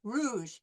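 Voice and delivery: rouge with the tongue pulled back; throaty r. Shown as a demonstration. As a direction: say rouge with a uvular r. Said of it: "we pull it back".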